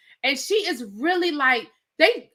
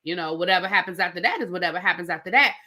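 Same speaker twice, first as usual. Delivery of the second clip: rushed retold quote; 6 semitones lower, 4 dB quieter, faster than usual. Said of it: "He did say".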